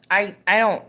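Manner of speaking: ordinary delivery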